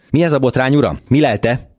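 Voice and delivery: high-pitched, indignant challenge at moderate volume; hoarse shout. A narrator's reading. Neighbour at a night window to Gavrilescu, calling out hoarsely. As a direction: call out sharply, pitched well up. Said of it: "rekedten kiáltott rá a sötétből".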